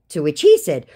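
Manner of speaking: ordinary talk, nothing marked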